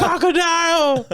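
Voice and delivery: very high, drawn-out delighted cry; mock ecstatic yell. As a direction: re-enact yelling it from the back, stretched out. Retells cheering from the back.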